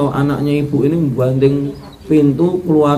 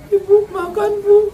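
high, tearful offering of food; crying. Acted. Daughter-in-law serves her mother-in-law while crying, high and tearful. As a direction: serve the meal through tears. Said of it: "Nyuguhkannya sambil nangis".

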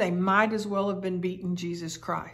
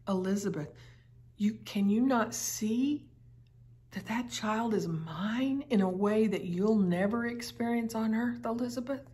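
usual speaking voice

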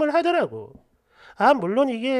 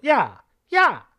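slow, drawn-out "야, 야!" while pointing; a hint of a rude mother-in-law jabbing a finger; mock finger-pointing call; 4 dB louder, much slower than usual. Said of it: "이렇게요". (삿대질하며 느리게 "야, 야!" 부르는 흉내)

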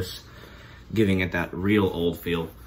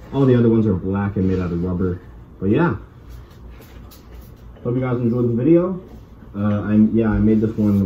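muffled behind mask; speaking inside respirator; through a gas mask; 8 dB louder than usual. Real, not acted. Seen 3: Full-face gas mask worn throughout; reference frames show bare face.

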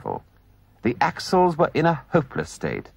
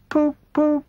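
high, far-off dreamy poop poop; dreamy murmur; 3 dB louder than usual. Acted. Toad murmuring after the motor car, dazed. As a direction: echo the motor horn in a trance. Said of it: "he faintly murmured".

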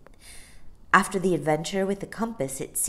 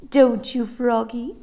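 higher, louder character voice; Amelia the chummy dolphin; 7 semitones higher, 8 dB louder than usual. Acted. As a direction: call chummily to a pal.

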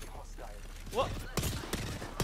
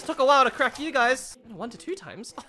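ordinary talk, nothing marked

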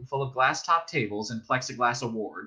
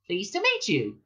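mouse voice; 7 semitones higher than usual. A narrator's reading. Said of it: "The other mouse said".

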